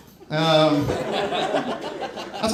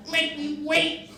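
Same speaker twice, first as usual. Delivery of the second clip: scolding; 9 semitones higher, much slower than usual. Retold or imitated. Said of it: "She was scolding the train".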